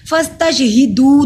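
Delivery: फस्तशहिदू with only the final दू held long; slow, loud recitation ending on a stretched दू; short hi, long doo in फस्तशहिदू; lengthened doo. Shown as a demonstration. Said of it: "दू को सिर्फ लंबा करना है".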